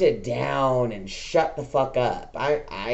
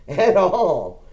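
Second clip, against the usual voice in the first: raised, firm scolding with a laugh breaking in; half-laughing reproach, raised voice; retold scolding of dad; 6 semitones higher, 5 dB louder than usual. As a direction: tell your dad off, louder and higher, half laughing.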